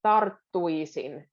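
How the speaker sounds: "tarttuisin" slowly, rolling the R into a held double T. Shown as a demonstration. Teacher showing the R-TT bridge in "tarttuisin". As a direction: join the R to the long TT of "tarttuisin" slowly. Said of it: "a bridge between R and a double T".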